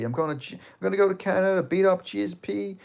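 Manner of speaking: high, mocking trash-talk voice, up about seven semitones; hammed-up Colby taunt. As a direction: sneer it out like a cocky heel. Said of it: "Colby line was about G S P".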